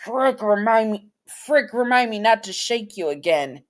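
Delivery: high, loud startled cry of pain; pained high outburst; startled yelp. Acted. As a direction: yelp it out, nose just broken. Kondraki startled after nose broken.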